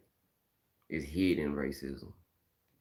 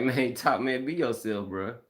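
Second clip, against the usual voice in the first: high, louder retold plea to a friend; exasperated urging; 7 semitones higher, 5 dB louder than usual. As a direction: urge a buddy, pitch jumping up.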